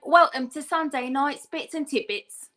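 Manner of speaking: announcer's welcome in Cockney; show-opening Cockney demo; Cockney accent. Shown as a demonstration. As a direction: welcome the audience in East London Cockney. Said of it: "in Cockney".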